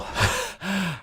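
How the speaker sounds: short, high chuckle; amused laugh. Real, not acted. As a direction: break off into a little laugh. Amused by Power's antics.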